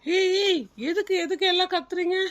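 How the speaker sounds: sharp classroom telling-off; teacher's scolding. Acted. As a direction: call the noisy class to order. Teacher quiets squabbling class.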